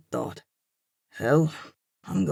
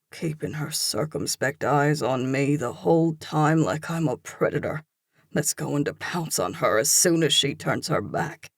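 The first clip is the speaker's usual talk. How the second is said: ordinary delivery, nothing marked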